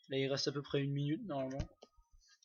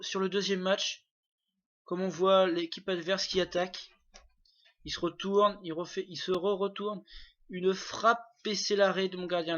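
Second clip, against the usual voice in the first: lively play-by-play, a bit higher; excited sports commentary; 4 semitones higher, 5 dB louder than usual. Acted. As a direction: call the action like a match commentator. Play-by-play of own match. (commentaire sportif animé)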